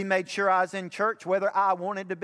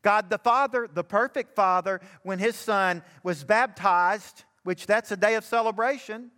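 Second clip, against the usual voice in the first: preaching; 5 semitones higher than usual. Acted. Preacher urging fathers from the pulpit, full-force preaching. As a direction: drive it home to the fathers in the pews.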